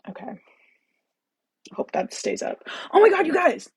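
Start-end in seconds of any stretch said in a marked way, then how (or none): 2.9-3.7 high, loud burst of excitement; loud, high-pitched excited exclamation; excited realization. Real, not acted. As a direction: blurt it as you suddenly remember. Suddenly remembers the candle.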